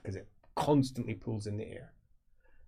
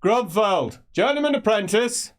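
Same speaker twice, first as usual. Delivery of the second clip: formal announcement; 10 semitones higher, 7 dB louder than usual. Acted. Magister formally announcing at door.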